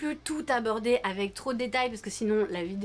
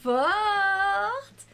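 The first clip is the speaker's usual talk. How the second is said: high, slow squealed praise; gushing praise; 9 semitones higher, 5 dB louder, much slower than usual. Acted. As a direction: squeal it like a fan. Mock delighted praise.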